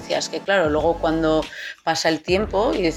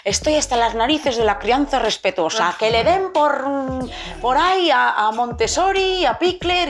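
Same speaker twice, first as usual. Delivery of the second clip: fed-up rant climbing higher and higher; exasperated parent; 7 semitones higher than usual. Retold or imitated. Parents fed up with respectful parenting, imitated exasperated rant. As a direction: vent like a parent sick of gentle parenting. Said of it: "a mí me suelen decir mucho".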